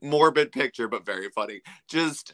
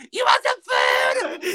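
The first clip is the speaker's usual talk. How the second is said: mock yell; 10 semitones higher, 4 dB louder, slower than usual. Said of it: "the whole time yelling".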